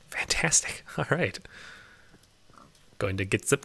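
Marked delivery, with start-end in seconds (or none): 0.1-0.8 unvoiced pleased whisper. Real, not acted. Pleased the pigs keep following, whispered. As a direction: breathe it out to yourself.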